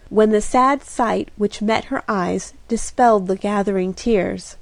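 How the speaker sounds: plain reading voice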